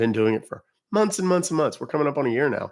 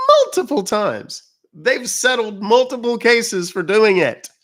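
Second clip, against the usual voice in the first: higher, louder, slower indignant push; heated rant; 9 semitones higher, 6 dB louder than usual. Real, not acted. Host ranting to chat about data-selling apps. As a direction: hammer home the outrage.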